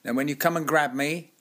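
put-on testy old director-actor lecturing a child actor, loud; tetchy Hartnell. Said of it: "He'd get a bit tetchy with you and say".

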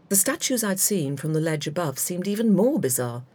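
ordinary delivery

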